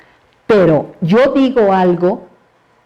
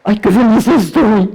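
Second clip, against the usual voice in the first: mock happy; 3 semitones higher, 4 dB louder than usual. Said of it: "Yo nunca diría".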